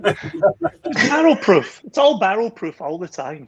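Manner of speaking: high, slow, put-on pronouncement; mock grand. Teasing his co-host about proof strength, mock lofty and slow. Teasing reply to joking complaint.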